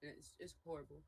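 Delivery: speaking with a swollen jaw; talking through a sore swollen mouth; jaw shaking. Real, not acted. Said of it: "if I close it, it shakes".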